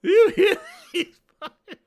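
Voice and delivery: high, laughing disbelief; words dissolving into real laughter; cracking up. Real, not acted. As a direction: lose it laughing mid-sentence. Cracking up at the crowd.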